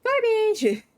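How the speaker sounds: high, loud yelp of outrage; indignant squeal; outraged shout. Real, not acted. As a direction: shriek it in mock outrage. Outraged at couch being trashed.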